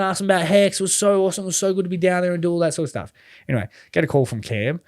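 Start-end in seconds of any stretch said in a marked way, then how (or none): 0.0-3.1 raised, bubbly, quick gush; gushing, overexcited. Retold or imitated. On a breakdown show, overexcited about Eternal. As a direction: mimic how he gushed on the show. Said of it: "I was probably, like, a little too excited".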